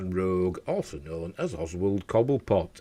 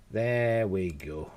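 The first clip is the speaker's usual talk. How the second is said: drawn out slowly, level voice; much slower than usual. Real, not acted. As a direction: stretch it out slowly. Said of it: "repeated settling phrase".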